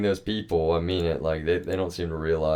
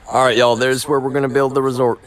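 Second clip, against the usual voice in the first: louder and a bit higher, showing off; loud announcement; 5 semitones higher, 9 dB louder than usual. Real, not acted. Showing viewers where the resort goes, loud announcement. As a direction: proclaim it to everyone watching.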